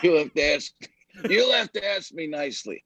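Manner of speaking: film-star impression on request; Jack Nicholson. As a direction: deliver the famous courtroom line as the star would. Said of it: "if he would just use the thing".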